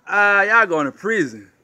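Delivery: ordinary talk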